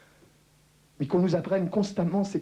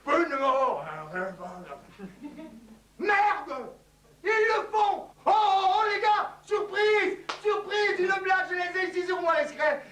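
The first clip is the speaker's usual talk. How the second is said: panicked shouting; 11 semitones higher than usual. Acted. Comedian's spy panics at torture, high frantic shouting. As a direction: squeal like a spy breaking under torture.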